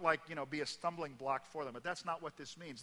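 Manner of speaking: ordinary talk, nothing marked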